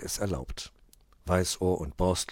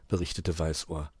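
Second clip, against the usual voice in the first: ordinary delivery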